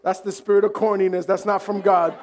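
normal talking voice

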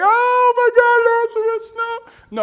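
high, loud, drawn-out wail of grief; mock wailing. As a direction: wail it out, hammed-up and over the top. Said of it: "It wasn't wailing like".

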